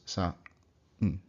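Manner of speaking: "sa" then "n" with a pause; split syllables. Shown as a demonstration. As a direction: sound each beat of san. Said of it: "two syllables".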